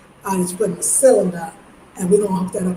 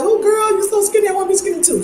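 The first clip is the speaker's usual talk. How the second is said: much higher, gushing admiration; gushing New Yorker; 10 semitones higher, faster than usual. Retold or imitated. New York women admiring skinny friend, high gushing. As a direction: gush over a friend's thin figure, up high. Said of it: "people be like".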